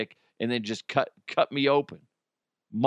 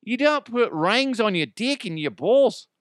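high, loud Australian firefighter; hammed-up Aussie tradie accent; Australian bloke shouting at the patient; mock Australian accent; 8 semitones higher, 3 dB louder than usual. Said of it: "they're in Australia".

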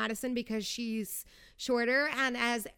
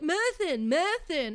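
higher, louder mock drunk slur; mocking Austin; 5 semitones higher, 4 dB louder, slower than usual. Mocking Austin's reunion meltdown, higher and louder. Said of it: "Remember when you were like".

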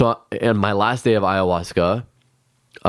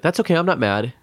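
put-on higher, gentle little-boy voice; a young inner child soothing a crying adult, higher and gentle; innocent child voice; 8 semitones higher than usual. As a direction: lightly voice a small innocent boy. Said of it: "He was like".

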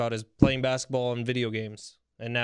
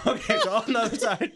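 higher, giggling through the break; can't stop laughing; host laughing while sending to break; 8 semitones higher than usual. Real, not acted.

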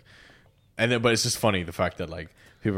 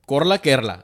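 higher, rolling Kerala accent on Korla Kerala; 4 semitones higher, 6 dB louder than usual. Shown as a demonstration. Showing how Kerala people say Kerala, rolling Kerala accent. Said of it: "Kerala, you know, there's, like, rolling".

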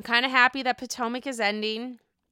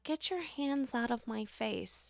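slow, very soft mock warning; Jackie; 12 dB quieter than usual. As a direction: imitate her warning, hushed and slow. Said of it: "Jackie's like".

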